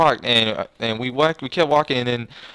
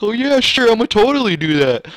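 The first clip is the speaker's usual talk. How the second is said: much higher, louder sing-song fake agreement; mocking sarcasm; 9 semitones higher, 5 dB louder than usual. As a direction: mimic a liar's cheery yes. Friend's fake promise retold.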